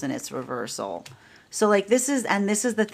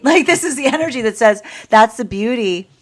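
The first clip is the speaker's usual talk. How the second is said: loud, raised pitch with a real laugh; 7 semitones higher, 8 dB louder than usual. Real, not acted. Reader delighted by the beauty of sacred energy, laughing. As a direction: burst out laughing and talk on through it. Laughs while explaining the reading.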